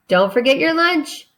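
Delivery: playful mock yell. Shown as a demonstration. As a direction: call it out like mom at the door. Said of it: "yelled mom".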